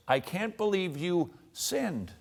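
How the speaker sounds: usual speaking voice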